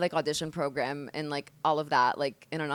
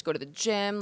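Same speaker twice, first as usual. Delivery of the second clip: ordinary talk, nothing marked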